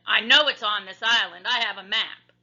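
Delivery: pirate boast. A narrator's reading. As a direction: boast about the map.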